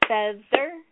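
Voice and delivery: "feather" modelled in two beats, FEA - THER; syllable by syllable. Shown as a demonstration. Teacher corrects a student by modelling "feather" in two distinct beats. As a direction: separate both syllables of "feather" for a learner. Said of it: "you would stop and model".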